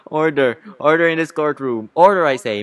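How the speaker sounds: loud, commanding judge; calling for order. Acted. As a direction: call the unruly courtroom to order. Judge calls court to order.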